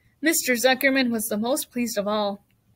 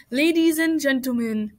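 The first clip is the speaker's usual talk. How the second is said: higher-pitched public announcer voice; loudspeaker announcer; 4 semitones higher, 3 dB louder than usual. A narrator's reading. Loudspeaker to the fair crowd, announcer's call. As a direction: address the whole crowd formally. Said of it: "continued the loudspeaker".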